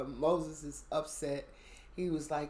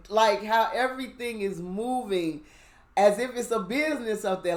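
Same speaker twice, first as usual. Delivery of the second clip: excited; 5 semitones higher, 6 dB louder than usual. Retold or imitated. Speaker marvels at heaven's business.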